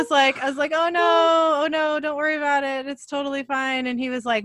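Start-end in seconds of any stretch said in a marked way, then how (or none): 0.7-3.9 reassuring mom. Retold or imitated. Mom reassuring scared son.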